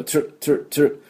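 "トゥ" as a quick "tr", no vowel between; double-consonant blend. Shown as a demonstration. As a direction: repeat "トゥ" as short English tr bursts. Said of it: "ダブルシーンのトゥ".

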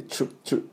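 チュ as a breathy "ch"-like tr cluster with no voice; チュ, a whispered tr burst repeated; tr sound drill. Shown as a demonstration. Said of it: "TですぐRに持っていかなきゃいけないんで". (「チュ」をささやくように)

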